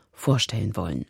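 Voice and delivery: ordinary talk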